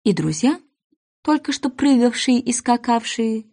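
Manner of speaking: ordinary delivery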